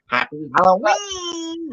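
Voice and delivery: very high, drawn-out singsong; gleeful prank reveal. Retold or imitated. Prankster revealing the fake spider to sister, teasing singsong. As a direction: stretch the last word up high.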